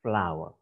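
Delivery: "flower" repeated clearly as /flaʊə/; teacher repeats "flower" slowly; model pronunciation. Shown as a demonstration. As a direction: repeat "flower" for learners to copy. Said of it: "One more time".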